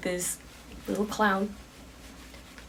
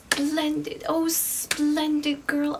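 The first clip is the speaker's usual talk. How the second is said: higher, drawn-out showman's delight; delighted clown; 6 semitones higher, slower than usual. Acted. Clown hears the girl will join his carnival, delighted. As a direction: savour each splendid in a raised voice.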